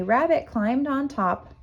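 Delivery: ordinary delivery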